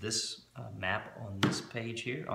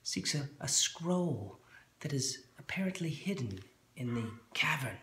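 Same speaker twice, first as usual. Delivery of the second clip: gnome voice; 5 semitones higher than usual. Acted. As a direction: lean in and murmur like the gnome. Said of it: "the gnome says".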